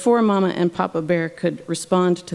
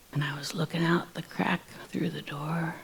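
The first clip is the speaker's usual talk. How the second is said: whispered storytelling from a hiding place; soft, secretive whisper while peeking out; hushed whisper; 11 dB quieter than usual. A narrator's reading. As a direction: drop to a furtive whisper.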